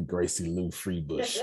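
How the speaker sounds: laughing through the name; giggling it out. Real, not acted. Laughing while naming movie character.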